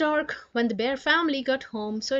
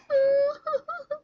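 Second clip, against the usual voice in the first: high sob; 4 dB louder than usual. Acted. Sister sobbing mid-story.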